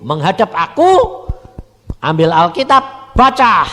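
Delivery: loud, high commanding preach; preaching as God. Acted. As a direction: give God's orders to the crowd. Preaching, voicing God's instruction. (khotbah lantang menirukan perintah Tuhan)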